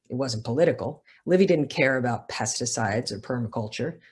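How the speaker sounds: measured, literary reading voice; story narration. A narrator's reading. Author reading her novel to a live audience, measured narration. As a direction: read it like an author at a bookstore event.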